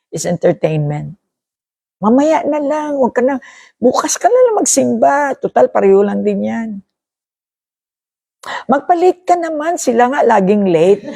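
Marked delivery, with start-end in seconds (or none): 2.0-11.1 high, breezy coaxing to skip church; a careless friend talking you out of Mass; voice of the world. Acted. Preacher voicing the world luring churchgoers, high and easygoing.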